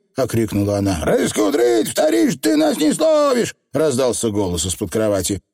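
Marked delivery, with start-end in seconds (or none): 1.0-3.6 squeaky pixie yelling defiantly; high, loud tiny rascal shout; shouting Feegle. Acted. Feegle shouting from under bed.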